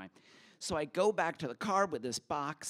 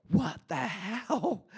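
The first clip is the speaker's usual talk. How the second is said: slow, higher, louder alarmed gasp of words; shocked; 7 semitones higher, 5 dB louder, much slower than usual. Retold or imitated. Sees police lights.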